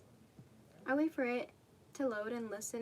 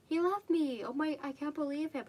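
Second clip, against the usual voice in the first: higher, louder outraged outburst; indignant shock; 5 semitones higher, 6 dB louder than usual. Real, not acted. Reader reacting to the story, shocked and indignant. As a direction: blurt it out in disbelief.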